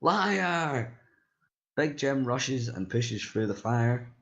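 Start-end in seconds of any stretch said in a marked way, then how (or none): 0.0-1.0 yell. Acted. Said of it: "Ying yells".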